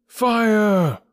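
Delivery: high, drawn-out alarm cry; yelled cry of fire. A narrator's reading. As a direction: yell it out high and long. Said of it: "I will trouble you once again".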